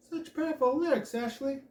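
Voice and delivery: put-on high, loud French accent; effusive French-accented praise; Madame Lamont raving. A narrator's reading. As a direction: rave with a thick French accent. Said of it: "raves Madame Lamont in her thick French accent".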